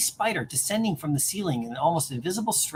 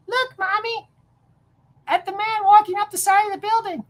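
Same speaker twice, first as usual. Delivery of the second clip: put-on high childlike voice pointing excitedly; childish high voice, louder on the second line; little kid voice; 13 semitones higher, 4 dB louder than usual. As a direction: squeak it out like a small child. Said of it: "that was my little kid voice".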